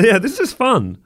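ordinary delivery

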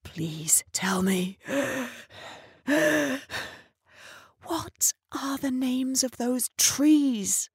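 slow breathless whisper. Retold or imitated. Breathless Miss Rose pleads with the narrator, slow whisper. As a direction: whisper it, barely managing. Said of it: "she finally managed".

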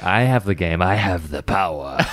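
mock villain. Voicing a ransom holder.

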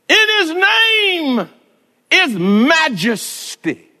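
very high, slow, drawn-out cry; whooping sermon climax. Acted. Pastor crying out the holiness of the name to the congregation. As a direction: stretch each phrase into a loud sung cry.

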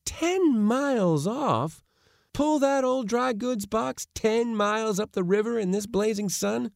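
high, incredulous outcry; aghast disbelief. A narrator's reading. Nephew told to row ten miles in sun, aghast. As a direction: protest in high-pitched disbelief.

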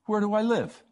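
なぜ？と戸惑って高い声で; baffled question. Retold or imitated. Retold baffled question to friend.

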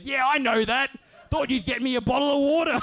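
high, loud, unabashed comeback; acted indignant justification; brazen retort. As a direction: pitch it up as a shameless admission. Man brazenly admits his trick.

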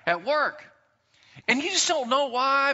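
normal talking voice

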